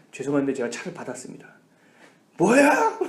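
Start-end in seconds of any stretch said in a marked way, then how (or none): 2.4-3.0 high, loud retold snap; angry outburst. Car owner hearing his car was hit, mock angry snap. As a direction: bark it like an outraged car owner. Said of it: "막 쌍욕이 막 나오기 시작해요".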